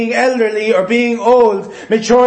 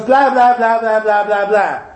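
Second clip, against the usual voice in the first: lightly imitating a two-year-old's babble; toddler babble. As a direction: babble like a toddler who thinks he's talking. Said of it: "And he goes".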